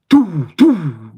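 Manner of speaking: two loud, punchy gunshot sounds made with the mouth; loud imitated gunfire from the street shootout; mock gunshots. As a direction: bang out the shots like a rocket launcher going off.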